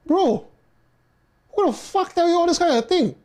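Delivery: who told you that nonsense, loud and high; outraged disbelief. Real, not acted. Reacting to odd viewer question.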